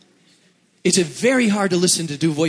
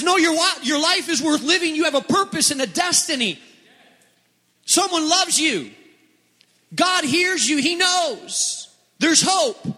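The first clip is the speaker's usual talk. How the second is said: much higher, bright pleading urgency; encouraging inner voice; 9 semitones higher than usual. Acted. Pastor voicing the hopeful voice to the congregation, higher. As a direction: lift it up with hope.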